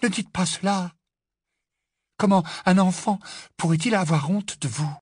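high, distressed Hermione; upset, pleading, pitched well up; tearful plea. A narrator's reading. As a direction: protest on the verge of tears. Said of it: "les larmes aux yeux".

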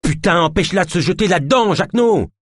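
high, urgent shouted order; frantic yell. A narrator's reading. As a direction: scream for help stopping someone. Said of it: "hurla Naya".

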